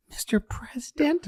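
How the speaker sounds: a breathy falsetto whisper of a question; Jackie, whispered. Retold or imitated. Comic imitating Jackie, whispering the question. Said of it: "you hear her go".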